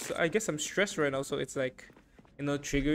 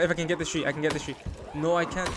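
usual speaking voice

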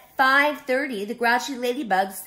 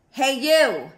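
loud, gruff challenge; grouchy shout; 4 semitones higher, 7 dB louder than usual. Acted. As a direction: bark it out grumpily. Grouchy ladybug challenges gorilla.

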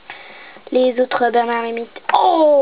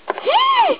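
much higher horrified gasp; sharp gasp of outrage; shocked gasp. Pretend. Horrified at insult.

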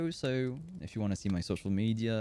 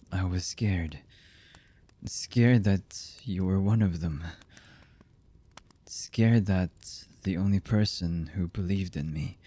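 slow, halting lover's voice with long pauses; hesitant, emotional confession; slower than usual. Acted. Lover confessing horrible deeds and love to the beloved, halting and emotional. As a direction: confess haltingly, one fragment at a time. Voice actor's romantic audio roleplay.

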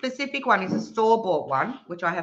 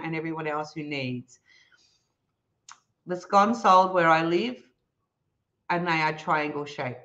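usual speaking voice

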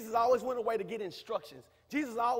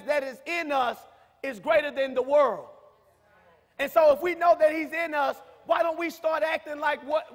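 preaching at full force, louder, rising to shouts; 6 semitones higher, 8 dB louder than usual. Acted. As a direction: rouse the congregation, building to a cry. Preacher exhorting congregation.

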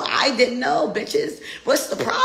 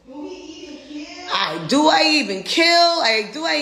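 exasperated outburst; 8 semitones higher, 3 dB louder, much slower than usual. Real, not acted.

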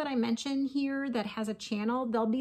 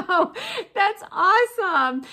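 laughing; 11 semitones higher, 10 dB louder than usual. Real, not acted. Laughing at card's crossed-out greeting.

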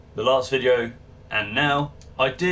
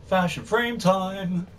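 announcer hype; 8 semitones higher, slower than usual. Acted. Announcing the show segment.

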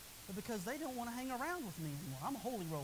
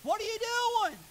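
high loud indignant "what are you doing"; mock protest; 10 semitones higher, 9 dB louder, slower than usual. Man in the skit protesting to God. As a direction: complain loudly like a hurt man. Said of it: "the guy would say".

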